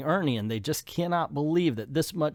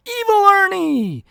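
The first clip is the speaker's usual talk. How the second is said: high, loud drawn-out yell; full-voiced acted cry of alarm; 10 dB louder, slower than usual. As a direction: holler the name up high, stretched out. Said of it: "and they yell".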